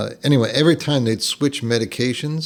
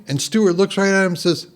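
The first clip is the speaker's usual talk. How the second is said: ordinary talk, nothing marked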